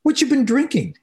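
a high, lightly voiced friendly old man calling out. Old man hails the drunk on the train, high friendly call. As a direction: greet him like a kindly old stranger, lightly played. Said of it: "in a very friendly tone".

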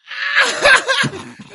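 high squealing laugh breaking out; can't hold in the laugh at the punchline; high burst of laughter. Real, not acted. Comedian cracking up at his own joke, squealing laugh.

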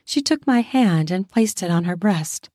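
ordinary delivery

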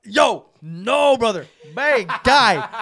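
loud, high, drawn-out objection; mock-appalled shout; alarmed protest. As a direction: cut in loudly as if stopping a friend. Acted protest to the roommate's insult.

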